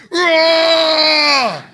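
long, loud high roar; full-voiced rallying roar; lion roar. Shown as a demonstration. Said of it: "roar like a lion".

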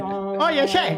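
high, mock Argentine "Oye, che"; playful imitation of Argentine accent. Teasing a friend by copying her Argentine accent. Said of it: "bien, bien de, de".

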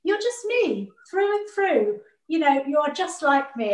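a high, lilting proud mum voice; proud mother. Retold or imitated. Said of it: "And she'd say to me".

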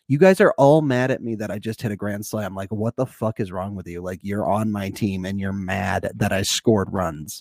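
low, baffled mock complaint; lightly voiced disbelief of a star player; a hint of an indignant player; voicing Tatis.

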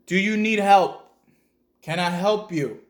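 retold child calling out to a stranger; calling out loud. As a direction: shout the offer of help into the dark. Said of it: "I yell out".

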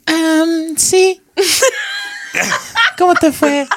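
high falsetto woman voice, laughing. Pretend. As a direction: put on a squeaky girl voice on request. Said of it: "A ver, haz una voz de mujer". (voz de mujer en falsete, riendo)

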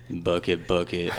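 low deadpan bucket bucket; deadpan robotic chant; Kawhi voice. Retold or imitated. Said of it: "he just goes".

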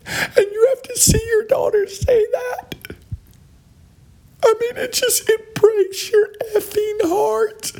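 high falsetto, fake tearful; mock heartbroken mother. Parodying the mother's post, high fake-crying mom voice. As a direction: ham up the weepy outraged mom.

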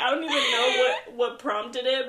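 normal talking voice

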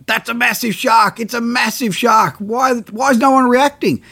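recalling panic at the oblivious crew; alarmed disbelief. Retold or imitated. Diver yelling up to the crew, alarmed.

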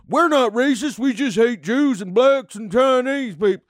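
loud, high hammed-up bigot. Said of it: "Can, uh".

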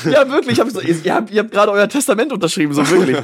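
laughing high and fast; giggling at how crazy the jump was. Real, not acted. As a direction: blurt it out through laughter.

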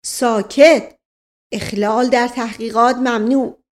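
loud official cry for silence; shouted order. Acted. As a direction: shut the room up like a police inspector. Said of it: "فریاد زد".

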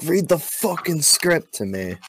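mock boss order. Boiler room boss ordering a worker, mock angry. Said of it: "and the guy was like".

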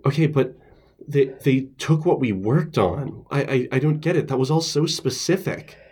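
high, upset disbelief; can't believe it, voice climbing; distressed disbelief. Acted. Character upset notes stolen.

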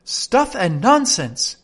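Alice loudly defying the queen. Acted. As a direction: fling the words back at the queen. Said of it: "said Alice loudly".

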